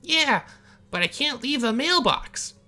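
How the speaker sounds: very high, loud squeaky stranger; a strange man yelling back from his mailbox; squeaky oddball shouting across the road; high odd stranger. Acted.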